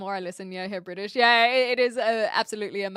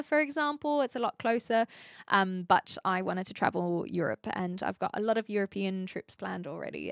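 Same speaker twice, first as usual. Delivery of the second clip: normal talking voice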